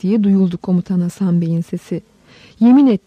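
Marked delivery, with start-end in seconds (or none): 2.6-3.0 higher, louder sharp command; shouted demand. A narrator's reading. Head monk demands an oath from the commander, shouting. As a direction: call out a firm demand. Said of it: "diye bağırdı başkeşiş".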